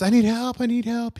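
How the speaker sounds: high, pleading repeat of the plea; a hiker begging God from the cliff; high and frantic, begging; desperate plea. Acted. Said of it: "And he goes".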